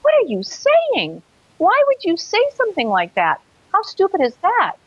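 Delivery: high, incredulous scolding of herself; self-scolding. Retold or imitated. Woman in the street chiding herself, high incredulous scolding. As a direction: snap at yourself for being silly.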